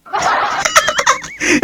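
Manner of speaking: high, soft laugh after the taunt; high mocking giggle; amused laugh. Real, not acted. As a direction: laugh at your own joke. Laughing at own jab.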